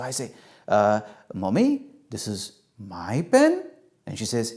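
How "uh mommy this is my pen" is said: slow, unsure, rising into a question; hesitant and drawn out, lifting at the end; tentative child. Acted. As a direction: try the answer out, seeking approval. Child hesitantly tries corrected answer.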